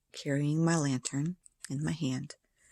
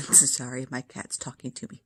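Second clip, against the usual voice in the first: normal talking voice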